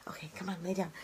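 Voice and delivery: quick hushed whisper off to the side; whispered aside. Real, not acted. Mom whispering to her restless daughter mid-livestream, hushed. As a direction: drop to a whisper so the viewers barely hear.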